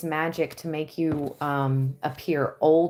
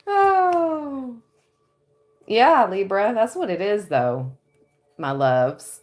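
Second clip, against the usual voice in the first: ordinary talk, nothing marked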